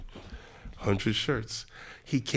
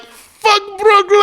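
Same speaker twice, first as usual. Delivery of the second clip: loud, high, slow rally shout; 6 dB louder, slower than usual. Retold or imitated. Masai on stage shouting to the fans, high and loud. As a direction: yell it to fire up the crowd. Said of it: "Masai goes on stage and he's like".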